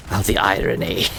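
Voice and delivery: imitating a gloating dark lord; Alarak voice. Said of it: "Alarak will be like".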